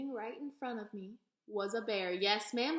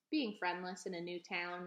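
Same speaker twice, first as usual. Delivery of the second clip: plain reading voice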